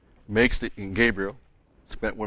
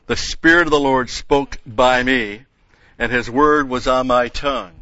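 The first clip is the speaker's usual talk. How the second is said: raised, louder oratorical Bible reading; scripture reading; 2 semitones higher, 6 dB louder than usual. Acted. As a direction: proclaim the verses to the congregation, phrase by phrase. Reads scripture aloud to congregation.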